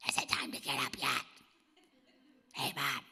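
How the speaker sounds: put-on a tiny child's loud raspy whisper; raspy toddler whisper. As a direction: whisper like a two-year-old waking mom at dawn. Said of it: "And that was her whisper".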